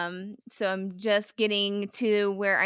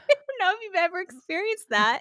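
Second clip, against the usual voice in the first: high, laughing question; 11 semitones higher than usual. Real, not acted. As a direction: laugh your way through it. Laughs while asking.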